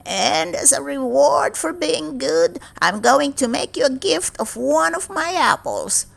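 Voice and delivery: velvety witch. A narrator's reading. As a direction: tempt her with sweet witchy charm. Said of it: "the old woman went on".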